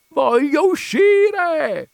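very high, long-drawn scream; enraged, drawn-out yell; furious shout. A narrator's reading. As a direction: scream it with every vowel stretched out. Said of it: "gridò Ziddima".

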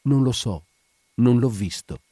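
ordinary delivery

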